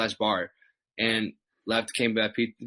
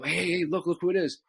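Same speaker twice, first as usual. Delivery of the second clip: higher, eager hushed nudge; excited nudge; 7 semitones higher than usual. Retold or imitated. Telling his girlfriend to look at Jericho: eager, higher hushed nudge. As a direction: nudge her, bursting to point him out.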